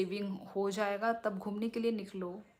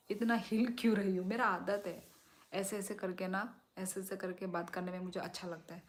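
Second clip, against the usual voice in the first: ordinary delivery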